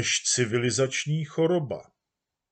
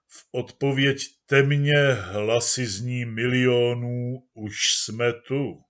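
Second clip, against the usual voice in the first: slow, louder solemn recitation; reciting verse; 5 dB louder, slower than usual. Acted. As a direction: declaim it like an anthem. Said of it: "on mluví ve verších". (slavnostní recitace veršů)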